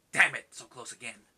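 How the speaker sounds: a half-hearted curse at just missing out; frustrated Keyes. Retold or imitated. Keyes just missing the spaceship, mock frustrated. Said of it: "and he's like".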